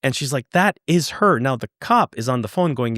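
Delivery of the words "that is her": higher, emphatic imitation of her; mildly mock-distraught, higher voice; retold tearful insistence. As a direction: act out her upset insistence, pitched up. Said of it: "she's screaming and she's crying".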